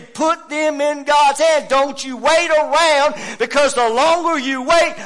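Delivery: loud, high revival preacher; full-force preaching. Real, not acted. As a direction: drive it home to the congregation.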